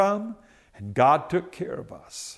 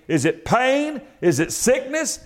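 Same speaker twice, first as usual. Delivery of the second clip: ordinary delivery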